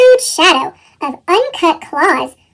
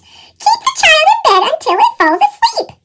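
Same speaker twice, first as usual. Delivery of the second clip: high, bouncy squeak; squeaky; 13 semitones higher than usual. A narrator's reading. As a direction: squeak it out while bouncing eagerly. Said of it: "bounced and squeaked".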